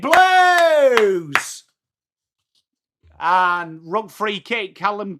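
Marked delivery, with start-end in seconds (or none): 0.0-1.6 high, loud rallying cry; cheering shout. Real, not acted. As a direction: shout it for the team. Cheering his team.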